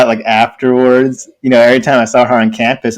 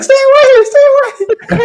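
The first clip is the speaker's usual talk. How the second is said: mock panicked shriek; 3 dB louder than usual. Imagined woman swinging cane at him, mock panicked shriek. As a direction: play frantic fending off.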